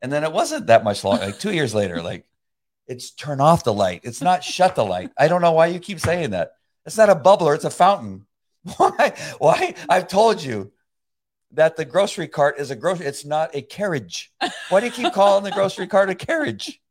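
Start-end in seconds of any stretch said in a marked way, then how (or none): 2.9-8.3 higher, louder mock scolding; mock nagging, raised voice; exasperated correcting. 8.8-16.8 higher, louder mock scolding; mock nagging, raised voice; exasperated correcting. Retold exasperated complaint.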